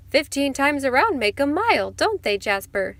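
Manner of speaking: high, loud, lively calling out; excited cry. A narrator's reading. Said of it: "she cried".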